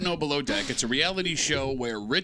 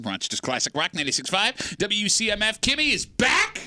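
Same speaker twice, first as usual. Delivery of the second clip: radio announcer; 7 semitones higher, 4 dB louder than usual. Acted. Radio station ID read.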